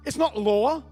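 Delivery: preaching at full force. Acted. Preacher to his church, driving home the truth with full force. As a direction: hammer it home to the congregation.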